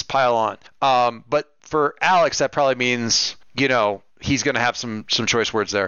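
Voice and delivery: usual speaking voice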